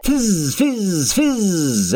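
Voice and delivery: high, rising fizz sounds. Acted. Narrator mimicking the rocket taking off.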